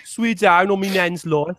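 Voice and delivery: slow put-on Brummie; mock-glum Brummie oath; Brummie accent. Shown as a demonstration. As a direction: drag it out in Birmingham accent. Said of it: "in the Brummie accent".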